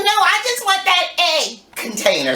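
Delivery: lightly voiced granddaughter; imitating granddaughter. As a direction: insist sweetly like a little girl. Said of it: "She goes".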